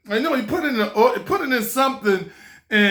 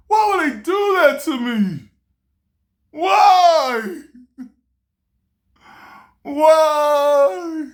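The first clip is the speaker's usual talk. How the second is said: higher, loud, half-laughing cry of why; mock anguished wail; 7 semitones higher, 5 dB louder, much slower than usual. Scammed young man wailing why at the world, in mock anguish. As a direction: wail it to the sky, half joking.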